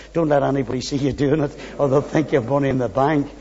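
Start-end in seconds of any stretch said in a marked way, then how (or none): none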